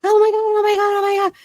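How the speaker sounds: high, loud mock panic; panicked. Son mid-scare before calming down, mock panic. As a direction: squeal it like you just saw a monster. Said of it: "like he went from".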